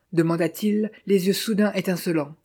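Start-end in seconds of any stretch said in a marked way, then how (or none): none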